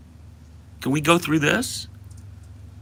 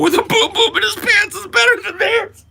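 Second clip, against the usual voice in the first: much higher, louder laughing squeal; mock partisan shriek; 5 dB louder than usual. Streamer mocking partisans screaming about their peepaw, high squeal. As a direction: squeal it like a squabbling fan.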